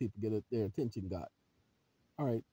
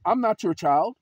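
loud, raised firm rebuke; indignant objection; 6 semitones higher, 10 dB louder than usual. Shown as a demonstration. Telling off a judge on the record, loud indignant objection. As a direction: push back hard at the judge. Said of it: "If they raise their voice, say on the record".

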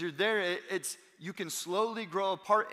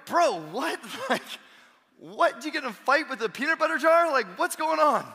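amused disbelief; 10 semitones higher, 7 dB louder than usual. Retold or imitated. Dad sees peanut butter face.

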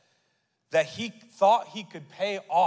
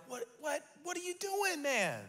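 incredulous; 11 semitones higher, 8 dB quieter than usual. Retold or imitated. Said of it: "you're like".

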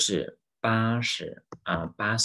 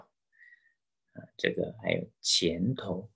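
usual speaking voice

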